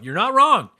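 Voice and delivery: usual speaking voice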